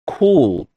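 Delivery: "Cold" with the L muted so it sounds like "cool"; "Cold" with the L swallowed into the vowel; British cold. Shown as a demonstration. ("Cold" con la L casi omitida)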